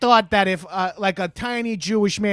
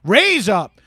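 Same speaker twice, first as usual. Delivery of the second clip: hype shout; 6 semitones higher, 6 dB louder than usual. Acted. Hyping up the caller on air.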